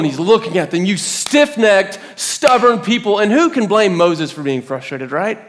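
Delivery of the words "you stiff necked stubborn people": high, slow, scathing rebuke; tongue-lashing. Acted. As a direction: hammer each insult at the crowd. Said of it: "gonna give the Israelites the tongue lashing".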